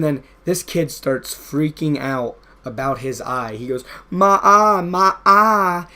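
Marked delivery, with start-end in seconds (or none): 4.1-5.9 put-on high, loud panicked wail in Billy's accent; mock Billy accent, loud and panicked. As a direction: cry out in Billy's accent like he's hurt. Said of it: "in his Billy accent".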